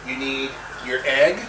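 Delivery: ordinary talk, nothing marked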